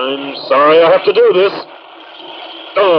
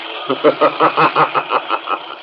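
sinister laugh; 4 dB quieter than usual. Acted. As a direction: laugh like an unseen phantom. The Shadow reveals himself.

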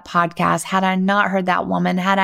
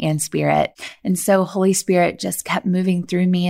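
ordinary talk

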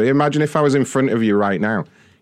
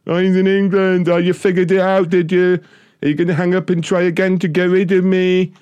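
taunting; 5 semitones higher than usual. Acted. Taunting caller after his insult.

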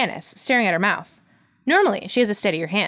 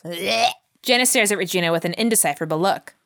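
normal talking voice

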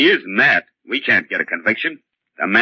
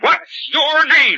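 much higher, slow insistent question; demanding; much slower than usual. Acted. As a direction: drag the question out, pressing hard. Interrogator demanding the name.

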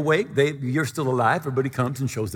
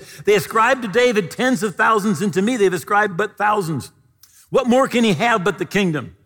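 Saul's anger; 5 semitones higher, 4 dB louder than usual. A narrator's reading. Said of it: "Saul was very angry at the saying".

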